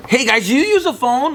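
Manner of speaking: high-pitched mock sales approach; phone-kiosk salesman. Imitating T-Mobile guys at the mall, high sales pitch. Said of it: "The, the T-Mobile guys?".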